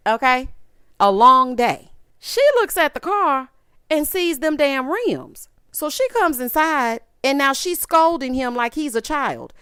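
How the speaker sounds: normal talking voice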